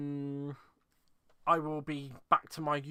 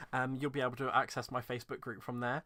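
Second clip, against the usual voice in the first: ordinary delivery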